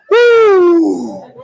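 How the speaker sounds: high, loud held whoop; joyful whoop. Real, not acted. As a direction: let out a burst of joy. Finally called by coach.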